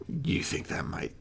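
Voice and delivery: sly conspiratorial whisper. Acted. Discord slyly asking the fillies, in a whisper. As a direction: lean in and breathe it.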